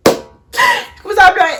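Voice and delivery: high, loud, slow wailed "Je commence à pleurer"; mock wail. Shown as a demonstration. She hams up her crying, wailing "Je commence à pleurer".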